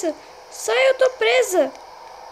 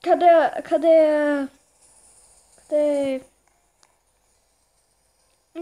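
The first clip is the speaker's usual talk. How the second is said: usual speaking voice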